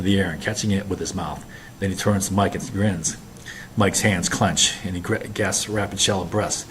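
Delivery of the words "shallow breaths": plain reading voice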